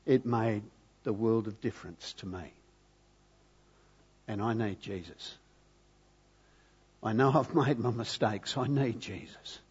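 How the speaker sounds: soft, low and heartfelt quote; hushed retold confession. Preacher voicing the daughter's response to him, hushed. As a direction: let the quoted admission sink almost to a hush.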